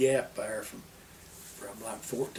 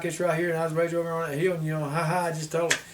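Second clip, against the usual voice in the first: porch sitter; 3 semitones higher, faster than usual. Retold or imitated. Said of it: "my daddy talked faster than I do".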